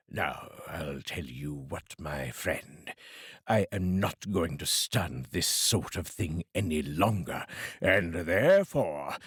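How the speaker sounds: string him along, menacingly; mock menace. Scrooge mock threatens.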